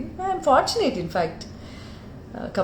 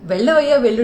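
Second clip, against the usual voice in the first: mock scolding line; 8 dB louder, faster than usual. Heroine dismissing a pestering man, loud playful scolding. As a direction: shoo him away playfully. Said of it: "డైలాగా".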